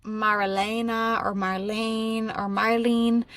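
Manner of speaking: slow, drawn-out sounding out; tentative recalling. Real, not acted. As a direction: feel out each name slowly, unsure of it. Recalling a half-remembered name aloud.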